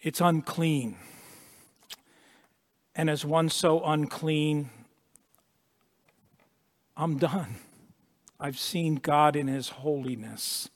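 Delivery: mild, hushed voice of Isaiah; hushed confession. Retold or imitated. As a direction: let it fall quiet and broken. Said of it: "what Isaiah is really saying".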